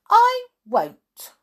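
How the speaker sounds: high, loud refusal; defiant. A narrator's reading. Alice defying the queen, high loud refusal. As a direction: refuse loudly, starting very high. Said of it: "said Alice".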